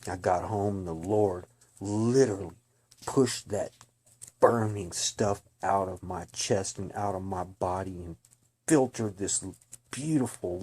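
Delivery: loud, urgent preaching voice; fervent testimony. Real, not acted. Witness tells listeners about seeing darkness, loud fervent preaching. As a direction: proclaim it like a warning from the pulpit.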